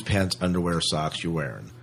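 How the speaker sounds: normal talking voice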